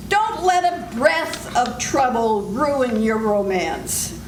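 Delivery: normal talking voice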